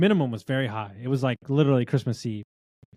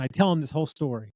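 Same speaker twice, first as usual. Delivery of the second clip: normal talking voice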